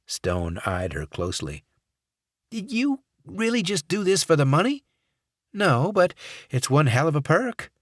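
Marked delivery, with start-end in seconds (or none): 2.5-7.7 high, louder, probing voice; sceptical question. Acted. Stone questions Watts's motives, high sceptical voice. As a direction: needle her about the money.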